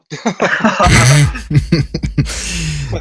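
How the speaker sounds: real long laugh. Real, not acted. Laughing at FIFA career mode.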